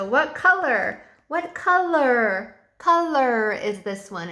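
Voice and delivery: slow, drawn-out question; slow teacher question. Shown as a demonstration. Teacher showing a card to kids, slow and drawn out. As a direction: stretch each word so a small child follows. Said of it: "hold the card up".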